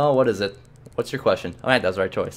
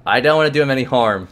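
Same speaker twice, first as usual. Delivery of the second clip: ordinary talk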